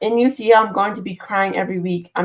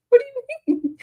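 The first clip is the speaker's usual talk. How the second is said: very high, squeaky "What do you mean?"; high-pitched silly mock question. Mocking a chat comment.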